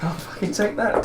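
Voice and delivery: laughing through the words. Real, not acted. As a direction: chuckle while gloating. Player laughs at lucky roll.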